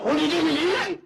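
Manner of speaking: high, loud insistent offer; loud urging. Acted. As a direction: push the food on them, high and loud. Insistent feeding at the table. (إلحاح بصوت عالي ورفيع)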